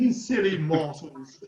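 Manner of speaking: slow, drawn-out cartoon villain impression; playful imitation of a haughty cartoon character; Stewie. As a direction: draw it out in mock-haughty disdain. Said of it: "a little bit like Stewie".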